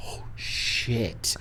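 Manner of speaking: whispered, stunned "oh shit"; hushed dread. Retold or imitated. Storyteller finding the snakes missing, hushed dread. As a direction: breathe it out low, stretched out.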